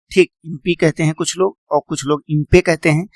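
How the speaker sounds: ordinary delivery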